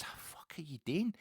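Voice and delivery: hushed whisper, almost to himself; whispered disbelief. Acted. Pundit muttering at Scottish football, whispered. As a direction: mutter it under your breath in disbelief.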